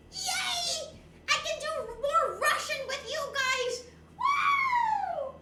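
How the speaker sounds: high squealing yay and woo; real excited cheer. Real, not acted. Telling chat the streak can still be extended, high excited cheering.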